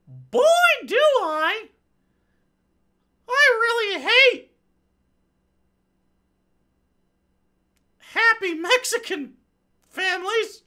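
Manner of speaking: high comic falsetto husband, slowed and sobbing; whiny husband voice. Pretend. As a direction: play the husband as a squealing big baby. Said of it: "her conservative husband".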